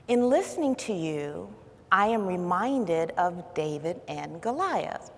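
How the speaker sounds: soft, calm friend's voice; quiet, soothing. Retold or imitated. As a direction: imitate gentle, measured counsel. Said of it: "in a very quiet and soothing voice".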